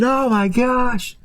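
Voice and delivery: stretched high "oh my gosh"; drawn-out squeaky horror; slow high gasp. Retold or imitated. Hot dog reacting to the sock, slow and high.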